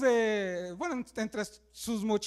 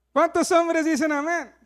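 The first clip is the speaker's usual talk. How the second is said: calling out; 3 semitones higher, 8 dB louder than usual. Real, not acted. Calling to the congregation.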